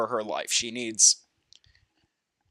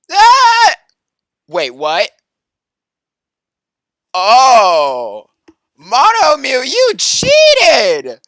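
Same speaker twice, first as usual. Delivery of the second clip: high, loud shocked accusations; loud stunned you cheated; shocked outburst; 10 semitones higher, 12 dB louder, much slower than usual. Real, not acted. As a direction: shriek it out in disbelief.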